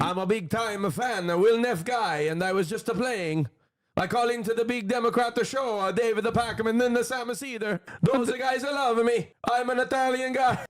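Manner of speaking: high, hammed-up Italian accent; cartoon Italian accent, way up in pitch; mock Italian accent. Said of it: "read it with an Italian accent".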